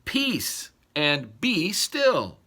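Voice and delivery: very slow, deliberate "Peace and be still."; slow command. Shown as a demonstration. Pastor showing the imperative to listeners, slow firm command. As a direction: draw out every word of "Peace and be still.". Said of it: "It is a command".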